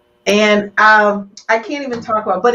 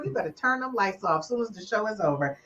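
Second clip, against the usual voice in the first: usual speaking voice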